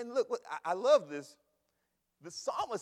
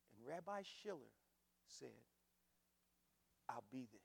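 quiet and low, with long pauses; soft, understated reveal; hushed, weighty telling; 8 semitones lower, 11 dB quieter than usual. Retold or imitated. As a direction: let each piece land softly, unhurried. Quiet first telling, later shouted.

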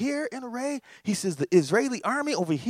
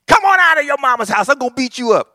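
loud taunting yell from outside the house; a neighborhood bully's loud challenge; street bully calling out; 6 semitones higher, 11 dB louder, faster than usual. Retold or imitated. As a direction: holler at him to come out and fight.

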